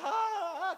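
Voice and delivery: loud, high imitated sobs; mock sobbing. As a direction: act out sobbing in bursts. Retold crying aloud.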